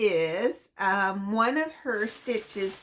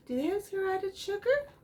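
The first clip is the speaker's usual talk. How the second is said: high questioning check; second-guessing the packet, pitched high; doubtful; 10 semitones higher, 3 dB quieter, faster than usual. Real, not acted. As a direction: pitch it up, suddenly unsure.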